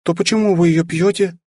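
put-on high, innocent girlish voice; naive elf girl. A narrator's reading. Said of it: "раздался наивный голосок эльфийки".

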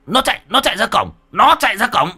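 high, hurried alarm; excited high-pitched pointing-out; urgent calling out. A narrator's reading. Pointing out the fleeing thief.

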